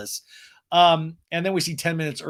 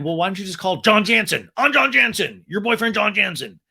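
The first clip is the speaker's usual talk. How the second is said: mock loud retort; 5 semitones higher, 6 dB louder than usual. Mocking John Jansen retort.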